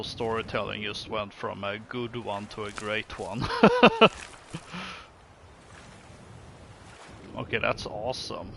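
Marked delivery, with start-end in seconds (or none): 3.5-5.0 laughing. Real, not acted. Joke about storytelling.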